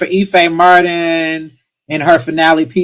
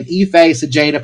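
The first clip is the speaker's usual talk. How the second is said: normal talking voice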